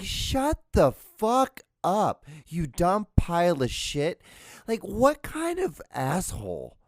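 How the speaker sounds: slow, high, punched insult; drawn-out furious put-down; angry rant. Real, not acted. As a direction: spit it out slowly in disgust. Ranting insult at podcast guy.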